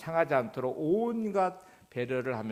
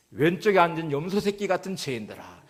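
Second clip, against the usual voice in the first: loud, higher cry with 왼쪽에 hit hard; loud pulpit shout aimed at the left side; preacher's shout; 5 semitones higher, 6 dB louder than usual. Retold or imitated. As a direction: call out 왼쪽에 like a preacher singling them out. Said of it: "또 이제 외치기 시작하네요".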